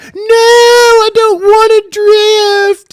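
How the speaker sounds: put-on a kid shrieking through tears in the shopping cart; crying toddler tantrum. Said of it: "he was like".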